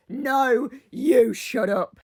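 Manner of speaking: slow, mock drunken comeback; drunk Linda. Drunk Linda answers Kathy back, slow and defiant. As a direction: drag out the retort like a stubborn drunk. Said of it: "drunk Linda is really funny".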